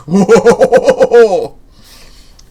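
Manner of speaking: big booming villain laugh; loud throaty hearty cackle. Acted. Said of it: "that's more of a hearty laugh".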